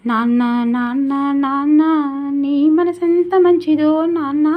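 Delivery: put-on high, loud, tender child's call to father. Teacher voicing a child praising his father, high loud call. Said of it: "Nannaya త్యాగానికి నిదర్శనం నాన్న".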